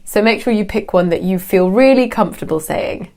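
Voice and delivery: ordinary delivery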